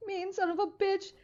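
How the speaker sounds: high, whiny fake hurt pleading; mock heartbroken. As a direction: ham up being unloved and wounded. Mock hurt begging for love.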